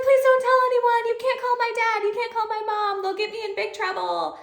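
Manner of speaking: high pleading please don't tell; pleading kid. Retold or imitated. Student begging teacher not to call her parents. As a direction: beg like a worried child. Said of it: "And she's like".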